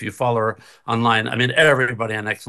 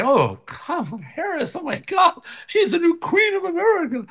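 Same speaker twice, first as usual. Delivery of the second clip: mock gushing fan.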